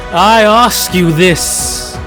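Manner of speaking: usual speaking voice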